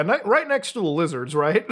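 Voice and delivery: ordinary delivery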